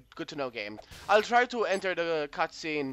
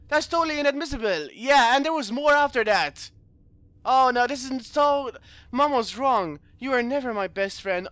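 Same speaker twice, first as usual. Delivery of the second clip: higher, louder protest at first, easing off; lightly voiced indignant character; 5 semitones higher, 6 dB louder than usual. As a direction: object as in a mock courtroom quarrel. Reads game characters arguing.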